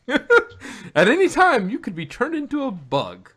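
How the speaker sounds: words squeezed out through a laugh, high and loud; giggling through the opening words. Real, not acted. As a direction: laugh while you say it, slowly.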